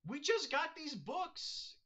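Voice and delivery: put-on high, slow, pleading kid's voice; wailing little kid over the burnt books; high child voice. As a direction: voice a dismayed child, drawn out. Reading a child's panel line.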